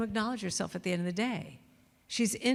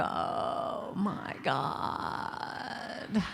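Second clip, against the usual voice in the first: slow drawn-out whispered groan; dread groan; 4 dB quieter, much slower than usual. Retold or imitated. Waking up dreading the day, a slow whispered groan. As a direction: breathe it out like you can't face the day.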